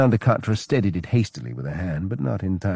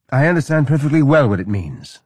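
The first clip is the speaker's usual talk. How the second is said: higher, louder sharp retort; snapping, higher and louder; snapped; 7 semitones higher, 6 dB louder than usual. A narrator's reading. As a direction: cut him off sharply. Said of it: "snapped Vainanda Katra".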